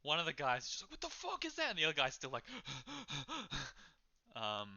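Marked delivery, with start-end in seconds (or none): 0.9-1.7 high-pitched shocked outburst; freaked-out patron, pitched way up; startled alarm. Acted. Startled patron reacts to monster. 2.5-3.8 rapid panicked panting; acted terrified hyperventilating; frightened gasping. Frightened patron still panting.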